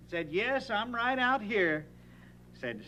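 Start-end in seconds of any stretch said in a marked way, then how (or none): 0.3-1.9 high, slow answering call; boy calling back to his father. Retold or imitated.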